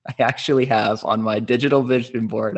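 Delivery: normal talking voice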